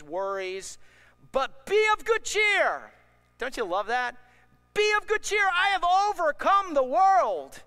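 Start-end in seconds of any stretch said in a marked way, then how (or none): 1.3-2.9 but be of good cheer rising into a loud high slow cry; preaching cry. Real, not acted. Preacher to congregation, rising into a loud cry. 4.7-7.6 loud high proclamation, slowing at the end; preaching at full force. Real, not acted. Preacher quoting Jesus to believers, loud and high.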